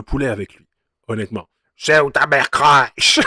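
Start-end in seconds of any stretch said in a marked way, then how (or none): 1.8-3.2 slow drawl with a diphthong; mock rapper accent. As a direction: imitate his drawl, slowly. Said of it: "Il a fait une diphtongue comme ça".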